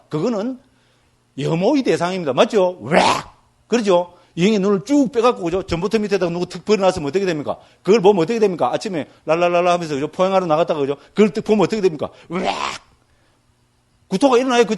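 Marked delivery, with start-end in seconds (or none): 2.8-3.0 imitated gagging noise; mock retching. Teacher mimics revulsion at a detached eye, mock retch. 12.3-12.5 imitated gagging noise; mock retching. Teacher mimics revulsion at a detached eye, mock retch.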